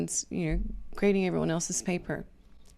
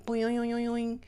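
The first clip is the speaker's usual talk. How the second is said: higher springy boing sounds; cartoon boing; 4 semitones higher, 3 dB quieter than usual. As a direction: bounce it like a spring toy. Sound effect for looping argument.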